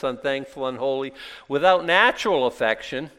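ordinary delivery

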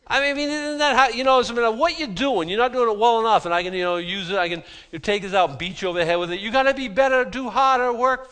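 high, loud mock haranguing; browbeating pastor. Pastor beating up the congregation with the Bible, loud high-pitched harangue. Mimics pastor who beats you up.

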